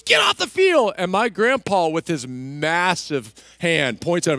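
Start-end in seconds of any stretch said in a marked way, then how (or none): 0.0-0.9 high, loud shouted order; mock referee yell. Referee ordering grandpa off the pitch, yelling high. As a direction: imitate a ref yelling someone off.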